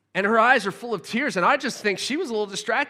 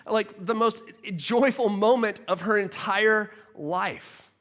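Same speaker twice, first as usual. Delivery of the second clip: ordinary delivery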